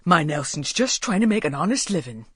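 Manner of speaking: put-on old countryman's voice, proud and steady; lightly voiced dignified old father; dignified old Walter. A narrator's reading. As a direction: defend his son with dignity. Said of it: "said Walter with dignity".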